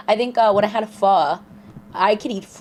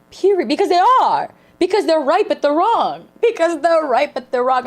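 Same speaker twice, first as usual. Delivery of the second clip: 1 emphatic agreement; 6 semitones higher than usual. Real, not acted. Agreeing loudly with sermon.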